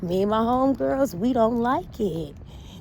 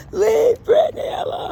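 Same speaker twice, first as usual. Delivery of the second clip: much higher, loud tearful shout; 6 dB louder than usual. Retold or imitated. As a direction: wail it like the viral fan. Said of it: "like that boy did".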